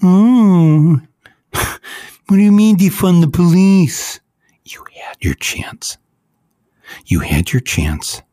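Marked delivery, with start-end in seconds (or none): 0.0-4.2 high, loud whiny mockery; hammed-up whine of a complaining cop; mock whining. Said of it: "Don't be whining about". 4.7-6.0 whisper. Real, not acted. Condemning the police.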